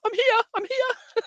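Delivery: impersonating John as an eager sitter calling out; eager man. Retold or imitated. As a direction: play a keen man waving his hand for attention. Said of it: "He's like".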